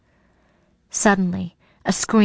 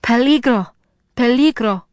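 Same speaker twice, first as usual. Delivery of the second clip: higher, louder cry of warning; narrated terrified cry, pitched up; terrified shout; 4 semitones higher, 4 dB louder than usual. A narrator's reading. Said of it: "Rosa's terrified voice shouted in my mind".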